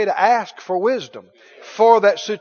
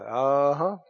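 low, soft, knowing uh-huh; knowing smile; 6 semitones lower, 5 dB quieter than usual. Retold or imitated. Jesus privately finding the answer, low knowing smile. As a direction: murmur it low through a smile. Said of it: "got a big smile and he thought".

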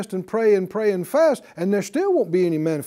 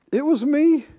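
timid confession; 7 semitones higher than usual. Acted. Woman owns up to Jesus.

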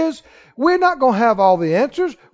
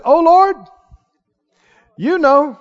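raised, trusting appeal; trusting reply; 3 semitones higher than usual. Acted. Prophet answering the Lord's question, trustingly. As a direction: answer the Lord with open trust. Acting Ezekiel's answer to God.

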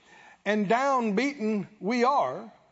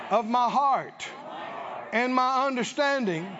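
earnest slow prayer. Shown as a demonstration. Preacher praying aloud to the congregation, slow and earnest. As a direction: pray it slowly, word by word. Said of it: "Come on, pray it right now".

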